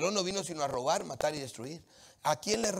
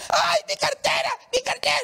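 hammed-up cry of the robbed lady; lady shrieking; 11 dB louder than usual. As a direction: wail like a woman who lost her purse. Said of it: "ya cuando la doña grita".

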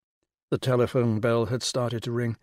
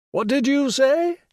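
high, loud regal demand; 13 semitones higher, 7 dB louder, slower than usual. Acted. The Rani demanding Will repeat himself, loud and regal. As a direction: snap the question back imperiously. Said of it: "the voice at the other end of the line demanded".

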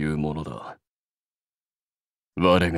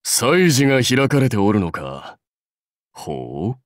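ordinary talk

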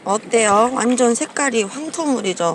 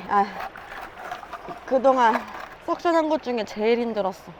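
ordinary talk

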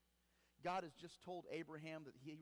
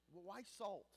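ordinary talk

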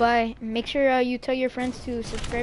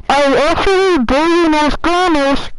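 suddenly much louder, higher blurt; loud confession; 3 semitones higher, 16 dB louder than usual. Real, not acted. Confessing loudly about knowing Spanish.

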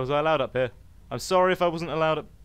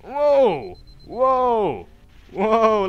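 high, loud, falling exclamations; amazed whoops; 6 semitones higher, 8 dB louder, much slower than usual. Real, not acted. Player seeing energy shields come online, amazed shouts. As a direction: exclaim in big awe.

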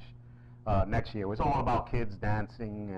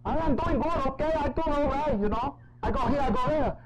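high, hammed-up English Spanish accent; 3 dB louder than usual. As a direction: fake a thick English Spanish accent to pass as a speaker. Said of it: "the English Spanish accent".

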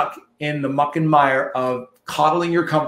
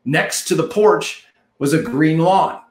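normal talking voice

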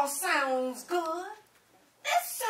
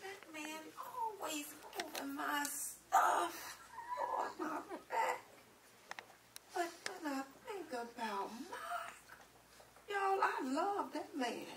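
much softer, tender complaint; soft and fond; soft confiding; 13 dB quieter than usual. Acted. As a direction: confide it gently to the audience. Wife's soft confiding monologue.

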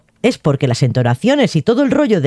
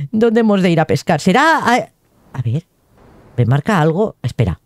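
ordinary talk

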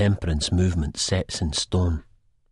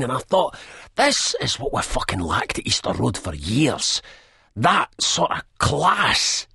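higher, louder, jabbering rant; coked-up rant; 7 semitones higher, 3 dB louder than usual. A narrator's reading. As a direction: rant about the footballer, wired on cocaine. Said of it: "he rants".

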